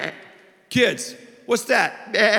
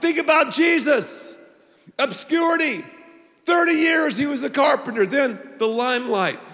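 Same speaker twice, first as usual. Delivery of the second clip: preaching climax; 6 semitones higher than usual. Real, not acted. Climax of sermon list.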